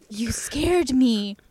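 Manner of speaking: slow whispered fright, playing it up; mock startled whisper. Boy faking his mom's sleepy startled whisper. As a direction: whisper it, pretending to be jolted awake. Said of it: "he goes".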